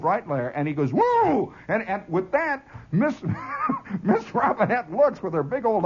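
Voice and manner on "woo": high imitated charge cry; charging whoop. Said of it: "Farkas pulls the Farkas move".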